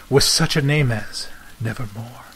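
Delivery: usual speaking voice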